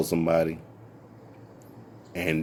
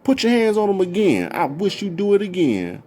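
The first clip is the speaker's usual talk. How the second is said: furious cussing out; 9 semitones higher than usual. Retold or imitated. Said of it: "and she said".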